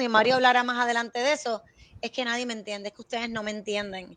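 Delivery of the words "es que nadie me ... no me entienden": queja de adolescente. Retold or imitated. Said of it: "Como ellos se pasan diciendo".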